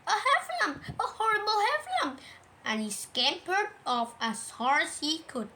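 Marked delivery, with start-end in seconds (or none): 0.1-2.2 high, squeaky horror; high-pitched terrified outcry; panicked Piglet cry. A narrator's reading. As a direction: squeal it in fright. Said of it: "cried Piglet".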